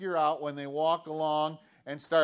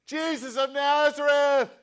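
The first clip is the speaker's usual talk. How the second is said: loud, high, insistent yell; desperate high call across a crowd; shouting all the more; 11 semitones higher, 11 dB louder than usual. Acted. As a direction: holler the name over everyone. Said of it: "he shouted all the more".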